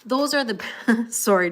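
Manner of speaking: breaking into a laugh mid-sentence; real laughter cutting off the thought. Real, not acted. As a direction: giggle through the words. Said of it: "Jill, I'm laughing".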